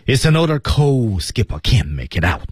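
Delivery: demonstrated line kept moving; not slow. Shown as a demonstration. Teacher models a film line for dubbing students, kept moving. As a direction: run "It's another cold skipper" along without dragging. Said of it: "这句话慢了就不行啊".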